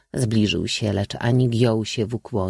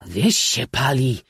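slow, lightly raised exclamation; baron calling out; 3 semitones higher, 3 dB louder, slower than usual. A narrator's reading. Baron exclaiming about the burning village, slow and raised. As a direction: draw it out as a mild cry. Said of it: "zawołał baron".

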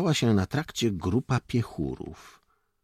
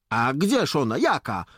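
raised, higher, indignant questions; huffy challenge; 7 semitones higher, 5 dB louder than usual. A narrator's reading. Jakub challenges Dońka about the road, bristling. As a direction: bristle and demand an answer loudly. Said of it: "zaperzył się Jakub".

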